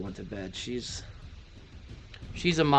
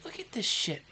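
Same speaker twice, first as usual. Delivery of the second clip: voicing the cat. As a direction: speak for the cat as if it's stuck.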